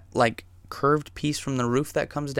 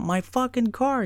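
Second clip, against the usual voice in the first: freaking out; 12 semitones higher than usual. Retold or imitated. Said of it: "He starts freaking out".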